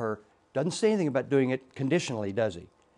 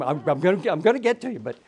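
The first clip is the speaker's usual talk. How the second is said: usual speaking voice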